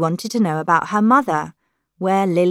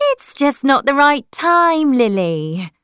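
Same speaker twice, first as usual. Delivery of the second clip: plain reading voice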